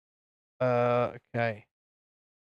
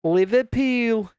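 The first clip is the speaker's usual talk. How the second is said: slow, higher put-on Scouse; Scouse accent; 5 semitones higher, slower than usual. Shown as a demonstration. Streamer practising the city's name, drawn-out Scouse. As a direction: draw the word out in a Liverpool accent. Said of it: "was that a good Scouse accent?".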